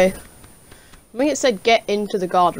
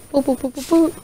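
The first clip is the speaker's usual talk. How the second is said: silly boos; 6 semitones higher than usual. Pretend. Silly boo sounds.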